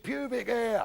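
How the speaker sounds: high-pitched, drawn-out schoolboy answer; schoolboy's answer. Acted. Pupil answering the teacher's question, high-pitched.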